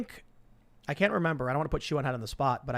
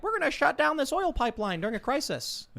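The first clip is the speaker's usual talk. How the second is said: high-pitched mock politician voice; hammed-up high impression; Whitmer; 12 semitones higher than usual. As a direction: put on a squeaky caricature, much higher than usual. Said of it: "how good your impression is".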